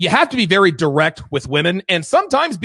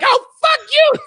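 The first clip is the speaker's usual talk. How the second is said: much higher, louder squeal imitating her; mocking girlfriend's retort; 6 dB louder than usual. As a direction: ham up her outraged comeback. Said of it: "And she's like".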